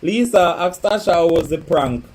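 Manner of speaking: loud, high call of the name; shouted name call. Real, not acted. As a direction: call Lisa across the room. Calling out to viewer.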